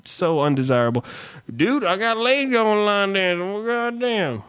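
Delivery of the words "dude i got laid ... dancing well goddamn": mock good old boy. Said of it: "mocking a line-dancing guy".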